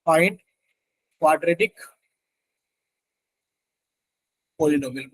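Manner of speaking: "find", "quadratic", "polynomial", each set apart by a long pause; word-by-word prompting. Real, not acted. Teacher re-reads the question for students, pausing after "find" and "quadratic".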